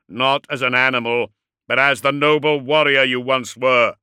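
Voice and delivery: booming challenge. A narrator's reading. Dreadnought challenges his cornered enemy to come out, booming. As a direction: thunder out a demand for surrender. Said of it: "he boomed".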